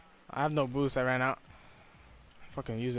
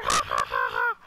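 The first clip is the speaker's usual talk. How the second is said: deep ha-ha chuckle; low staccato laugh; faster than usual. Pretend. Gamer laughing after own jeer, low staccato laugh. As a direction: drop very low for separate ha-ha beats.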